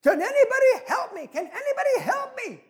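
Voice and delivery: high, loud, desperate cry for help; high-pitched frantic yell of a stranded tourist. Acted. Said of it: "he yelled out and said".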